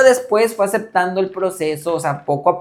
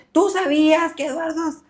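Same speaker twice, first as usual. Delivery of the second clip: put-on higher, whiny drunken accusation; a drunk grandfather's tearful reproach; mock drunk grandpa; 12 semitones higher than usual. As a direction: throw the blame at her, tearful and drunk.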